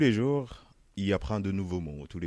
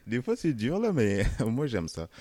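laughing; 6 semitones higher, 6 dB louder than usual. Real, not acted. Laughs about raising kids.

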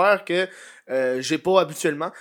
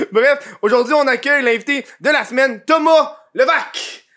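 host announcing; 6 semitones higher, 5 dB louder than usual. Real, not acted. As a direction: hype up the guest's name. Introducing the guest.

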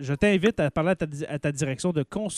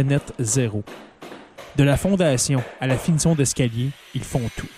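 ad read; 4 semitones lower than usual. Acted. Sponsored ad read.

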